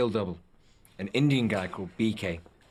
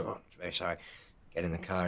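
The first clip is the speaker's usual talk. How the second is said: softer, meek sorry; meek apology; 2 semitones lower, 8 dB quieter, much faster than usual. Retold or imitated. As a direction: apologise quietly and meekly. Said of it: "and Viggo go".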